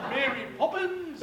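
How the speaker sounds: high, loud and drawn-out outburst; comic exclamation. Real, not acted. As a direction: blurt it out slowly and loudly for laughs.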